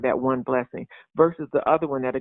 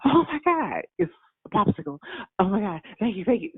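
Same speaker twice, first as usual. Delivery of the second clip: put-on overexcited child; 7 semitones higher than usual. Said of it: "that one is gonna go".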